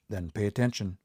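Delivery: normal talking voice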